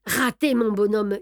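ordinary delivery

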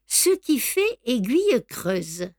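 ordinary delivery, nothing marked

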